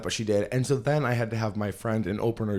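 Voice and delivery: ordinary delivery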